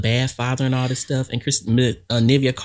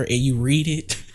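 usual speaking voice

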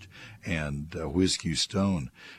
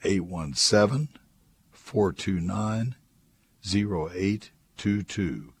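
measured radio contact details with long pauses; slow and deliberate; 3 semitones higher, slower than usual. Real, not acted. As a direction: dictate it slowly, piece by piece. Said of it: "let me do it slowly".

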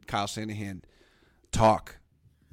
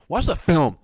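exasperated; 5 semitones higher, 8 dB louder, slower than usual. Shown as a demonstration. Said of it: "louder repeat".

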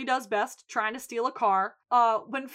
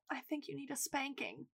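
much softer, teasing playful threat; mock-mad flirting; 2 semitones higher, 16 dB quieter than usual. Fiona to Jimmy as foreplay, hushed flirty mock threat. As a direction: drop it right down, suggestively. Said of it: "she acts, like, all mad, but it's just foreplay".

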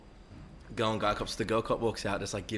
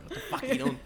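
high, laughing mock shout of an angry cop; retold cop yell. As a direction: bark it like a startled cop, half laughing. Cop yelling at him, retold.

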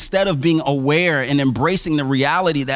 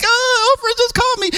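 very high-pitched squealing fan wail; hammed-up blubbering of a thrilled fan; mock ugly cry. Fan just praised by her idol, mock ugly cry. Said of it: "she got the ugly cry".

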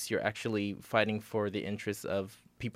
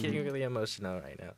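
emotional; faster than usual. Real, not acted. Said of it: "I'm getting emotional".

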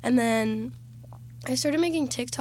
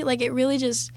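ordinary talk, nothing marked

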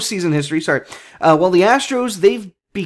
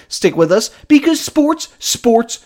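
announcer intro; much slower than usual. Acted. Show intro echo announcement.

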